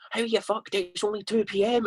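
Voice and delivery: high nasal impression of a bandmate; Jordan impression. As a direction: do your nasally mate, high up in the nose. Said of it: "That's such a good impression of Jordan".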